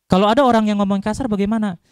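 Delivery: loud preacher's challenge; emphatic loud question. Real, not acted. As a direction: raise your voice to challenge the congregation. Preacher stresses a point to audience.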